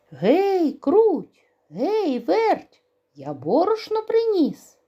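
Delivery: high, loud rooster call. Acted. Rooster calls the mice back home, loud high call. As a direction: call the mice from across the yard.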